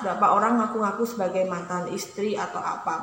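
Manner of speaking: ordinary talk, nothing marked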